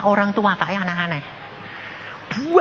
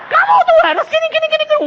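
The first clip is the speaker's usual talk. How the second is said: loud, high, rapid scolding; hammed-up angry lecture; acted scolding; 5 dB louder, much faster than usual. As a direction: rattle off a telling-off at high pitch. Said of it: "saya malah seneng tak marahin".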